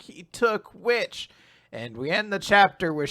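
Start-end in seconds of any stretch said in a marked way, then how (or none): 0.0-1.3 slow, dazed. Retold or imitated. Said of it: "Hermit's just like".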